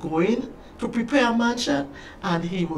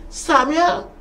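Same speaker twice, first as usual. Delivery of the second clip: loud, high call of a name; raised voice, pitched well up; shouted call; 8 semitones higher, 5 dB louder than usual. A narrator's reading. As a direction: shout out for your lost son. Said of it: "and he shouted".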